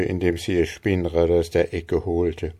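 plain reading voice

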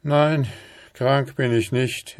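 leicht gehobene, lautere, verzagte Männerstimme; betrübter Mann. A narrator's reading. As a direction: abwinkend und mutlos. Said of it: "entgegnete er". (ein Hauch von Verzweiflung)

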